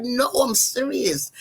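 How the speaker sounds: imitating an insistent mother, high and slow; earnest insistence. Mother reassuring her doubting daughter, retold with high insistence. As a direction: draw it out high, mock-earnest.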